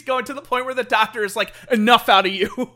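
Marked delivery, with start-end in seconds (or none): 1.7-2.7 much louder, exasperated cut-off; mock scolding. Doctor silences punning patient, loud mock scolding. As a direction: put a firm stop to the jokes.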